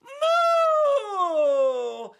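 loud, high, long moo; huge cow moo, sliding down. Acted. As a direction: bellow like a cow. Animals burst out at the farmer.

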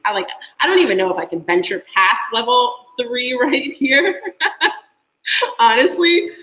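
laughing through the words. Real, not acted. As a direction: laugh while talking. Laughing about level three.

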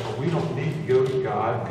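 ordinary talk, nothing marked

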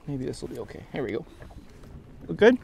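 ordinary delivery

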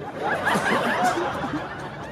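high breathless burst of laughter. Real, not acted. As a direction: break up laughing at your own punchline. Bursts out laughing at own story.

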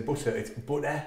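Middlesbrough "butter", said low and soft; "butter" in a Middlesbrough accent, quietly. Shown as a demonstration. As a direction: demonstrate Teesside accent on "butter". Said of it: "a guy saying about the Middlesbrough accent".

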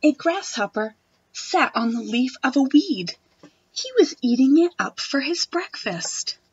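put-on expressive read-aloud for children; reading a picture book to a class; storybook reading. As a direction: read it like story time for little kids.

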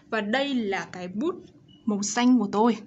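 ordinary delivery

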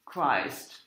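normal talking voice

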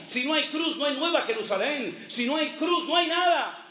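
rising preacher's litany, each line building; fervent sermon refrain; preaching. Acted. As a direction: hammer out each no hay like a preacher. Preaching repeated cross litany.

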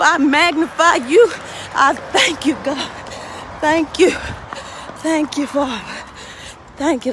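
earnest spoken prayer; heartfelt thanks to God. Real, not acted. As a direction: pray it aloud with feeling. Prays aloud in car.

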